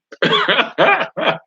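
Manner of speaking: high, bursting laugh. Real, not acted. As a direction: break into laughter at the question. Teacher laughs at student question.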